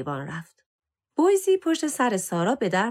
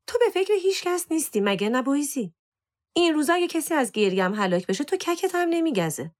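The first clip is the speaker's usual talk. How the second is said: higher, accusing complaint; reproachful scolding; 7 semitones higher than usual. A narrator's reading. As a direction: reproach the cat for not caring. Girl scolds her indifferent cat.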